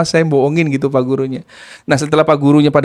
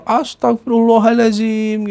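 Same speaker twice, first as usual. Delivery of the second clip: higher, slow drawn-out mock cry of shock; retold shocked cry; 6 semitones higher, slower than usual. Aunt retelling her shocked cry after being kicked, drawn out and high. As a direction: cry out to God, stretched and high. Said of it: "Saya sampai teriak".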